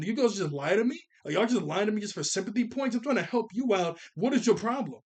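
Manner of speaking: mock indignant scolding; imitated exasperation. Acted exasperated rebuke of crowd.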